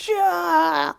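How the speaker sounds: loud high yell to open the fight; battle cry. Acted. Hero yells at Craven to start the fight. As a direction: shout it out to ambush the hunter.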